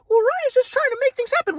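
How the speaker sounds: high, airy-calm imitated grandpa; spiritual grandpa. Grandpa from Kauai lecturing Ryan, high serene mock voice. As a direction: preach oneness like a serene guru.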